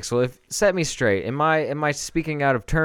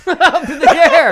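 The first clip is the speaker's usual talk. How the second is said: laughing; 11 dB louder than usual. Real, not acted. Teasing laugh at the balloon story.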